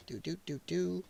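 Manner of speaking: very soft little doops under the breath; idle doop noises. Real, not acted. Host busy grabbing a link, idly doop-dooping. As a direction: hum tiny filler noises while busy. Filler while copying a link.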